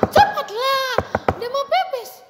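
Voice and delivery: put-on pushy little kid; rude impatient child. Shown as a demonstration. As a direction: whine with a laugh. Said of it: "mana bisa seperti itu".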